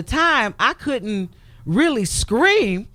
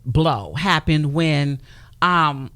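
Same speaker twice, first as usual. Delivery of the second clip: usual speaking voice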